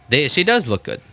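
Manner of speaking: normal talking voice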